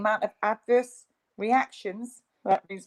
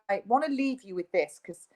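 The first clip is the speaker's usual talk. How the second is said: usual speaking voice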